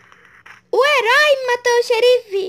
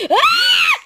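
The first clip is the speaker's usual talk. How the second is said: fright scream; 5 dB louder than usual. Real, not acted. Jump scare in game.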